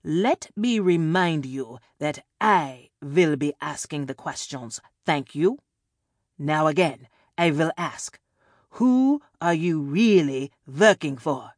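put-on European-accented interrogator, emphatic and loud; villain taking back control, European accent; forceful, measured questioning in a European accent; hard-to-place European accent. A narrator's reading. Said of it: "a hard-to-place European accent".

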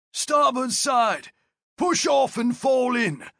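high, slow shouted command; gruff barked parade orders. Acted. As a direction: bark the order across the boat. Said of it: "Martius ordered".

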